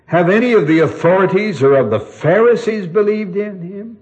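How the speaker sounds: high, loud and lofty; pompous pride. Retold or imitated. Pharisees boast of their own authority, pompous and superior. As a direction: look down your nose at them. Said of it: "the pride and the pompous, uh, superiority".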